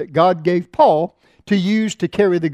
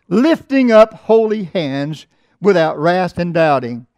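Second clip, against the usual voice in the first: scripture reading; 5 dB louder, slower than usual. Acted. Scripture read slowly.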